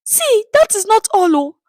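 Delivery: very high, plaintive voice; woman piling on her complaints; wailing woman. A narrator's reading. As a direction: keep wailing to the crowd.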